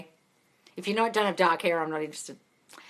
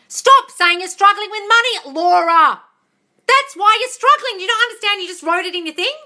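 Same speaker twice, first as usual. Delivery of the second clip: exasperated scolding; 10 semitones higher, 8 dB louder than usual. Acted. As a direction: scold a client in theatrical exasperation. Scolds viewer for negative self-talk.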